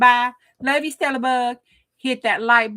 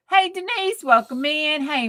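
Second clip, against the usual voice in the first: ordinary talk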